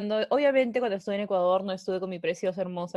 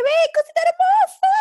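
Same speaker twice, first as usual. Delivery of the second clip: very high, loud squeal at a beloved dog; piercingly high, gushing call; retold cooing to pet; 10 dB louder than usual. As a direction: squeal it like baby talk to a pet. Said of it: "yo le dije".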